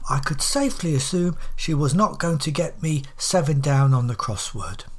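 plain reading voice

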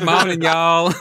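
hammed-up Southern morning greeting; Southern accent. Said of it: "speaking in Southern accents".

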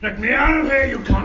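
furious high shout; angry. Acted. Locked-in flatmate screaming to be let out, furious shout. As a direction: roar it through the door in a rage. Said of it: "I just want you to hear how angry you're getting".

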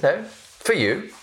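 put-on an old general's ominous correction; pointed general. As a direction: make the point land heavily.

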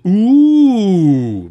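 loud, long, high jeering ooh; a whole room going ooh; mocking crowd ooh.

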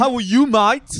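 loud, high hammed-up British accent; English accent. Host mocking English speech for co-hosts, British accent. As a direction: greet in an exaggerated British accent. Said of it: "avec l'accent anglais".

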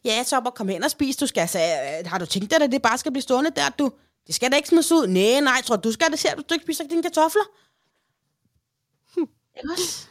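nagging grandma echo. Retold or imitated. Mother-in-law echoing her orders to the grandson, mock nagging. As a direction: pile on the nagging, getting shrill toward the end. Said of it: "hører jeg bare sådan et ekko".

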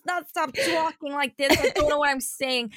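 chatty, improvised New York accent; exaggerated New Yorker patter. Shown as a demonstration. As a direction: ham up a New York accent and keep rambling. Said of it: "do a New York accent".

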